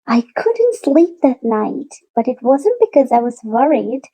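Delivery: ordinary delivery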